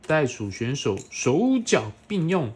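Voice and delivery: put-on bright, animated read-aloud for a small child; reading to a toddler. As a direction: read it like a bedtime picture book. Parent reading picture book to child.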